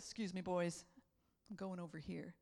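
low, quiet and cool; a hint of a cool unbothered lion; calm lion. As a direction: brush them off softly. Lion brushing off others.

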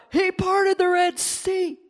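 high, slow, loud proclamation; excited shout of wonder; charged exclamation. Retold or imitated. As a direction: announce the miracle with awe.

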